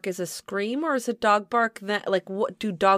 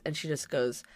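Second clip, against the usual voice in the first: ordinary talk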